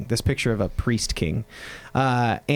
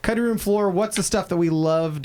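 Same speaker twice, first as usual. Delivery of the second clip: segment announcer; 7 semitones higher than usual. Acted.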